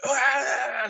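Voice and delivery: long, high, loud "aaah" yell; imitated yell. Stranger on a bike yelling at a driver, imitated yell. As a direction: let out a drawn-out wild yell. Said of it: "starts yelling".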